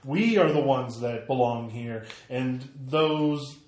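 proud, rising oration to a crowd; rally the crowd like an orator; rousing speech. Acted.

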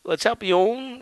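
a hint of a commentator's belt call; mock announcer. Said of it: "when you hear them saying".

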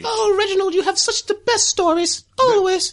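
high, fawning falsetto lady; gushing lady. Acted. Lady gushing to Reginald over his stories, high falsetto. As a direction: coo like an admiring lady.

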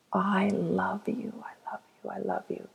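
repeated whispered love, fading away. Acted. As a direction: let each I love you dissolve into a whisper. Repeated love to inner child.

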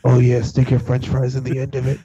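low, booming sales pitch; deep infomercial voice. Pretend. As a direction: ham it up like a TV pitchman.

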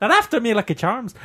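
mock Irish leprechaun, Lucky Charms style; bad Irish accent. Man to friends, trying an Irish accent, high bad Irish accent. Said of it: "I can't do a very good Irish accent".

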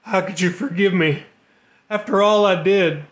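a man begging forgiveness through tears; weeping plea. Acted. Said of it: "Top broke into open weeping".

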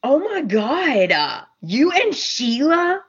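high, slow, drawn-out disbelief; hammed-up shocked question, swooping high; imitated scandalized gasp. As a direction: draw out the mock horror. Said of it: "And Steffi goes".

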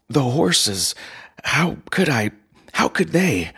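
rising panic, voice climbing; startled nephew, pitch leaping up; incredulous. Acted. Nephew stunned by the plan.

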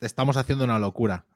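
ordinary talk, nothing marked

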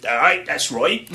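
lightly attempted Scottish accent; poor Scottish accent. Old drum teacher answering a new pupil, rough Scottish accent. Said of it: "that's not my best Scottish accent".

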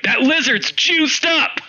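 slow, high-pitched mock West Wing gravitas; Bradley Whitford in a hammed-up West Wing voice. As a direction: deliver it like a dramatic West Wing line. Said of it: "full West Wing voice".

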